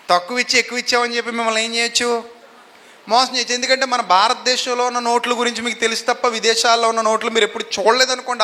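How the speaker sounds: ordinary talk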